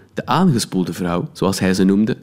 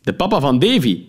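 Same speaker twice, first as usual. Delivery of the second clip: de papa van Davy said higher, as the chummy policeman; jovial cop; 6 semitones higher than usual. Acted. Policeman reminds narrator who he is, chummy. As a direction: sound pleased to be recognised.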